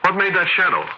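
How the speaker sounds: high, sharp, startled question; panicked, pointing at the wall; frightened cry. Acted. Frightened outburst in restaurant.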